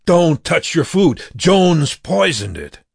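high, urgent warning cry; shouted warning. A narrator's reading. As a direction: call out to stop them eating. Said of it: "he shouted as he raced".

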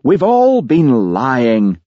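slow, anguished howl; Jimmy howling his confession, slow and pained. A narrator's reading. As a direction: drag it out in misery. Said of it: "howled Jimmy".

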